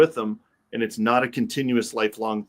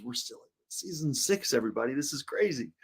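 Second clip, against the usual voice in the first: whispering; 8 dB quieter than usual. Real, not acted. Host's aside to the audience.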